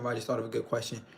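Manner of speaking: usual speaking voice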